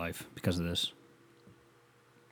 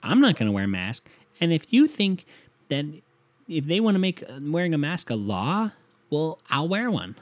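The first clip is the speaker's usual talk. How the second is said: high, sneering mock condescension; lightly mocked snooty girl, pitched way up; condescending; 6 semitones higher than usual. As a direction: talk down to everyone like a know-it-all. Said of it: "really condescending about it".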